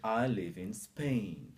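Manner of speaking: SPAIN as one syllable straight from S; clean initial S. Shown as a demonstration. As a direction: slowly glide from IN into a clean SPAIN. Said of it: "Not in a Spain".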